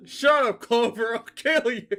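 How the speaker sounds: high, loud snarl; high, loud threat; furious threat. Acted. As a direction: bark it at Clover to shut her up. Leader snaps at Clover.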